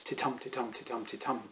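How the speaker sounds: monotonous "ti-TUM" pulse, softly repeated; iambic rhythm. Shown as a demonstration. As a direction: rattle "titum" off quietly as a regular beat. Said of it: "didn't just speak in this".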